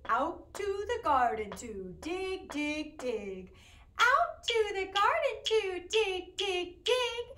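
a lightly voiced chanting pig. Teacher voicing the pig for the children, chanted. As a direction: chant it like a busy little pig. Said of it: "when I was talking like the pig".

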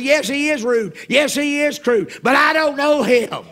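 loud, high, slow emphatic phrases; exasperated shouting. Acted. Passenger concedes rudeness but disowns the man, exasperated shout. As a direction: hammer each point out slowly and loudly. Insists he is a stranger.

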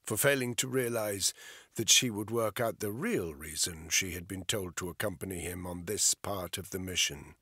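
ordinary delivery, nothing marked

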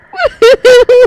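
high burst of loud laughter. Real, not acted. Gamer laughing loudly after a jump scare. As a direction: break into a shrieking laugh.

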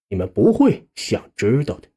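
plain reading voice

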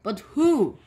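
shout. A narrator's reading. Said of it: "shouted a man".

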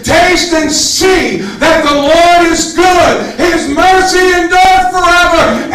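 ordinary talk

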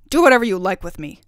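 loud, fast, exasperated defiance; defiant. A narrator's reading. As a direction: snap back as a stubborn king. King defies the fairy.

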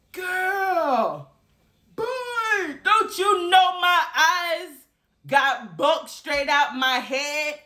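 high, slow crying-out shock; shocked outcry. Retold or imitated. Telling viewers about the rat, high slow shocked cry. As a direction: wail it out in disbelief.

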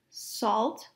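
plain reading voice

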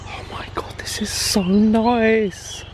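hushed, drawn-out whisper; awed whisper. Real, not acted. Traveller taking in the lake view, awed whisper. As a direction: whisper it slowly in wonder.

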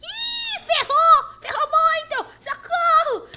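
high, loud screams for help; panicked screaming. Real, not acted. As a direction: scream like you're being chased. Chased by Annabelle in game.

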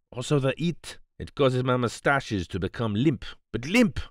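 put-on fussy, aggrieved foreign accent, often lifted high; lamenting Poirot. A narrator's reading. Poirot moans to Hastings about sand and heat, lamenting in a foreign accent. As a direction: complain with mounting indignation in accented English. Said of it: "I cut short his lamentations".

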